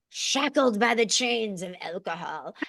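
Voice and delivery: slow, cheesy mock-dramatic declaration. Real, not acted. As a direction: draw it out like a corny slogan. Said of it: "this is gonna sound so cheesy".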